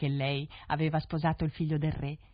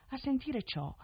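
usual speaking voice

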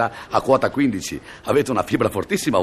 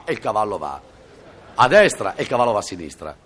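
usual speaking voice

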